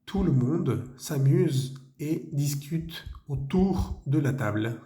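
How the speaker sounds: slow French read of the sentence. Shown as a demonstration. Teacher reads the last French sentence to the class. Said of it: "nuestra última frase".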